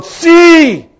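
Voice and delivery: very high, loud cry of yes; joyful shout. Acted. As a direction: cheer it out. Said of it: "Y dijeron".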